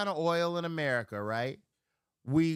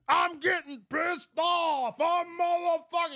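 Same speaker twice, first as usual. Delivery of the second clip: higher, louder mock angry shout; uncle flaming up; 12 semitones higher, 5 dB louder than usual. Imitating uncle getting pissed off, mock angry shout. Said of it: "he start flaming up".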